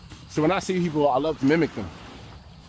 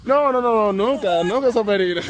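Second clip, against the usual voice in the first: excited loud joking; 6 semitones higher, 5 dB louder than usual. Real, not acted. Joking loudly in Spanish.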